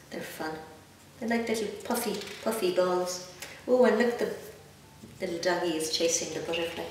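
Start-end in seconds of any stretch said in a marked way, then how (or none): none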